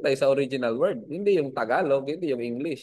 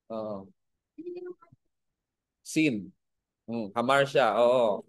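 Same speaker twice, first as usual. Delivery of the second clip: ordinary delivery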